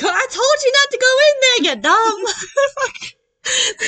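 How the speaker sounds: high, loud mock yell through laughter; hammed-up cackling scolding at the screen; imitating laughing heckler. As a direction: squeal it out, dragging the last words into laughter. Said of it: "she was just sitting there laughing".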